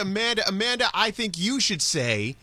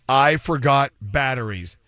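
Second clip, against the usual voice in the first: slow ad line; 4 semitones lower than usual. Acted. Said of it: "the three scariest words".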